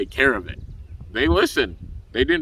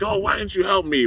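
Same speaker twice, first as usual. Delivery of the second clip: a higher, whiny mock complaint; upset whining; 9 semitones higher than usual. The coach whines to the teachers who stayed out, mock upset. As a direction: whine at the teachers like a sulking kid. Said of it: "he looks over at the teachers all upset".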